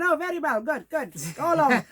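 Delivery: loud, high, comically relieved approval; mock brisk dismissal. Senior girl dismissing the sick child, comic loud relief. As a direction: send them off loudly, imitating a satisfied elder.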